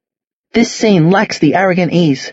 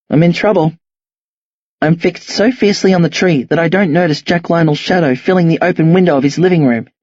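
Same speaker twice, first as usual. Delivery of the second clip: ordinary delivery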